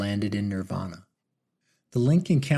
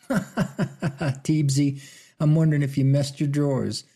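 teasing through a laugh, higher; laughing boom; 4 semitones higher than usual. A narrator's reading. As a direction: laugh it out at a friend. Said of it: "boomed Big Jim French".

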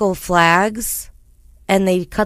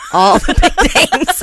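louder, laughing through the words; 8 semitones higher, 4 dB louder than usual. Real, not acted. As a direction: crack up halfway through.

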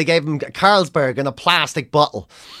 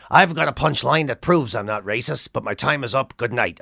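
much lower, gruff-edged Canadian comic voice, weary and done with everything; Mike Wilmot's Canadian accent; 6 semitones lower, 4 dB quieter than usual. Retold or imitated. A veteran Canadian comic sneering about a young act, in a low Canadian accent. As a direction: do the jaded old Canadian comedian. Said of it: "I'll do it in his accent".

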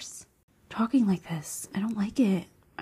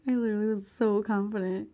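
ordinary delivery

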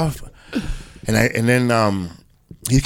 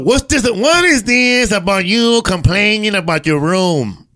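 high, slow teasing demand; mock scolding boss; 6 semitones higher, slower than usual. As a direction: lightly imitate a boss on the phone. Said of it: "he calls me up".

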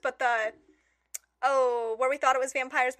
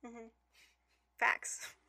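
ordinary delivery

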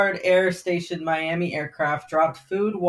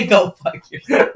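laughing mock insult; 2 semitones higher, 7 dB louder than usual. Echoes joking insult, laughing.

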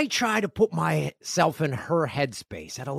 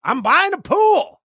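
much higher, louder, slow shout; ecstatic kid shout; 13 semitones higher, 6 dB louder, slower than usual. Retold or imitated. Daughter announcing to dad she is buying a pool, thrilled shout. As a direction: shout it like an overjoyed eleven-year-old. Daughter repeats her news.

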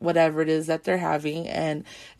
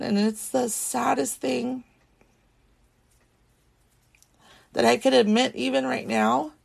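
strained, pitched up, near tears; holding back tears; 5 semitones higher than usual. Real, not acted. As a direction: keep the crying voice in check. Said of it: "trying so hard not to have my ugly crying voice".

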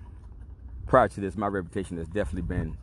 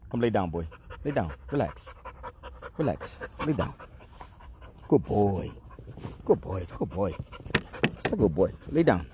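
coaxing the dog. Real, not acted.

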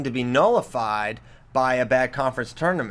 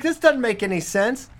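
put-on raised, complaining boy voice; kid complaining; 7 semitones higher than usual. Son complains about the stars, raised kid voice. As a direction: pitch up a little and protest. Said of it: "He's like".

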